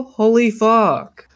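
slow, high amazed exclaim; drawn-out exclamation. Real, not acted. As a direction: stretch it out in awe.

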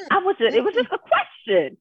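high-pitched, stammered self-defence; defensive protest. Real, not acted. Defending her hot flash question, real high defensive protest. Protests after being scolded.

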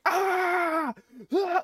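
very high, drawn-out mock scream; imitated scream. Two friends screaming at each other over the accident, mock scream. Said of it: "just started screaming".

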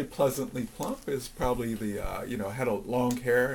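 ordinary talk

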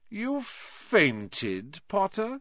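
high, gleeful, drawn-out taunt; drawling and delighted. A narrator's reading. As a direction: draw out the key word with mocking delight. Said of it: "a drawling, delighted voice".

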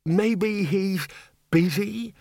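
high, timid, hesitant bursar; quavering. A narrator's reading. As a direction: venture it nervously in a high quaver. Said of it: "Eventually the bursar quavered".

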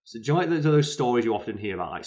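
normal talking voice

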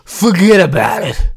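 put-on light New York accent, forget about it drawn out; hint of a New York accent. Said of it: "That's my New York accent for the day".

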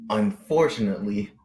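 ordinary talk, nothing marked

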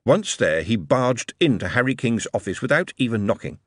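ordinary delivery

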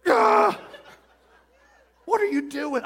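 high startled shriek; startled nurses crying out. Retold or imitated.

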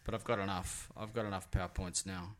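ordinary delivery

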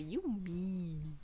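soft, low mock of a parent; lightly voiced parent cutting someone off; imitating their mother. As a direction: quietly mimic a parent giving the silent treatment. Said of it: "They're always like that".

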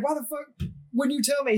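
high-pitched retold outburst; flipping out. Airman confronting his sergeant in a retold freak-out, flipping out. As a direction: imitate someone losing it, pitch jumped up. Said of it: "he just flips out".

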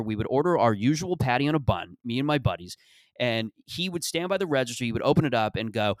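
normal talking voice

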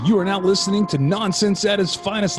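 booming showman announcer, high and loud; ringmaster. Acted. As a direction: roll up the crowd like a circus host. Show intro over music.

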